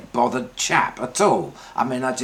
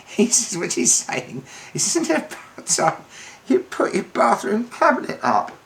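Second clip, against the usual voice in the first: high-pitched, laughing through the words; 9 semitones higher than usual. Real, not acted. Comedian retells the medium's cabinet message, laughing as he speaks. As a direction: crack up while quoting the message.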